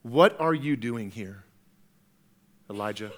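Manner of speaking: low, very soft, unhurried question; God's gentle whisper. Acted. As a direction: ask gently, falling away to a hush. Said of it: "God in the whisper".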